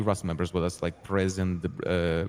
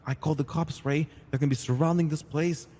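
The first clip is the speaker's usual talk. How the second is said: pitched-up, agitated retold threat; urgent warning; 6 semitones higher, 8 dB louder than usual. Buddha telling Ray the cops are coming, urgent. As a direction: rush him out with a higher-voiced warning.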